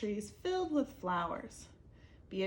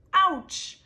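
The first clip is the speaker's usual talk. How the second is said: sharp high cry of Ouch; loud ouch; 9 semitones higher, 13 dB louder than usual. Acted. Abel smacking into a branch, yelping loud. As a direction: yelp it out in pain.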